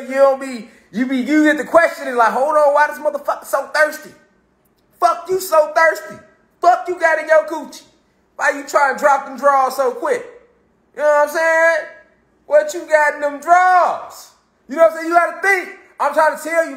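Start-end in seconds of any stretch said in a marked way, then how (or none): 2.3-10.2 high-pitched, mock-suspicious grilling; incredulous questioning. Man grilling an overeager admirer, incredulous. 12.5-14.3 high, drawn-out mock interrogation; incredulous questioning. Imagined questioning of a pushy admirer.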